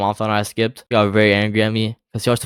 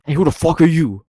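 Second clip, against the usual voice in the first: imitated angry challenge, higher pitch; angry man; 7 semitones higher than usual. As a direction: play an older man confronting an intruder. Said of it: "the man looked very angry".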